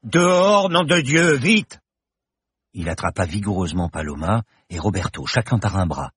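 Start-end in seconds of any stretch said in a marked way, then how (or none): 0.0-1.8 much higher, louder frantic yell; shout. Acted. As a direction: bellow them out of the room. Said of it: "Il hurla". (hurlement affolé)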